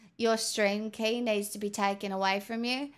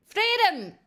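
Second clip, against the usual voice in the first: higher and louder, triumphant; triumphant cry; 9 semitones higher, 5 dB louder than usual. Real, not acted. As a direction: shout it out like a release. Accent challenge finally ends.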